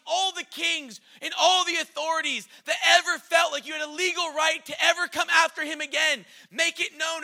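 high, loud herald proclaiming a decree; royal decree. Acted. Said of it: "this, like, legal language saying".

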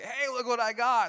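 high, loud taunting call; mock excited shout. Preacher voicing David calling to Saul, loud teasing shout. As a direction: shout it like a kid showing off a prize. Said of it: "David ran up and was like".

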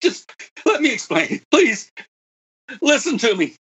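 high, pleading retelling of a desperate plea; mock desperate begging, pitched high. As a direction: beg him to let you explain. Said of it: "I begged him".

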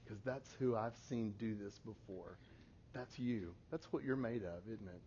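soft, low parental reassurance; hushed and steady, coaxing them to keep going; gentle encouragement. Retold or imitated. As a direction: quietly build up a struggling child. Parent voiced calmly encouraging struggling kids.

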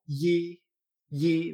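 yi with a consonant Y, like ll; yi a la española. Shown as a demonstration. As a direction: start yi with a firm Spanish Y. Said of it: "Como si fuera una especie de L". (yi con Y consonántica, como elle)

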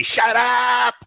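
sudden high yelled "Shut up!"; mock yell at a crying infant; mock shout. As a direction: snap at the crying baby, hammed-up. Shaking a crying baby, mock.

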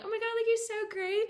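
high-pitched mock gush; smitten gushing. Islander really into a guy, gushing. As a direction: play a girl swooning over a new guy. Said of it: "we're, like, really into it".

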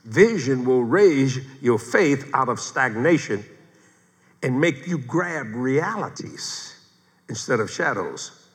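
slow, measured dictation; deliberate phrasing with stretched words and pauses; dictating. Real, not acted. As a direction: spell out the point slowly for note-takers. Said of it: "Write this down".

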